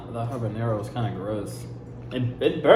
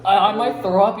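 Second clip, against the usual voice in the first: laughing; 8 semitones higher, 6 dB louder than usual. Real, not acted. After the Reaper bean.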